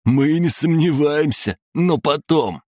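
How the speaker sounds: high, mocking brush-off; playful torturer. Torturer brushes off victim's confession, playful high voice. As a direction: put him off in a high, toying voice.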